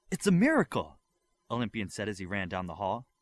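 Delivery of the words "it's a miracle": high, loud burst of joy; excited cry. Acted. Olympian running down the hall with news, loud excited cry. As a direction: announce it like the best news ever.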